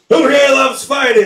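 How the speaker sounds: a fair announcer firing up the room; mock ring announcer calling to the crowd; hyped announcer. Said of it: "and I'm quoting".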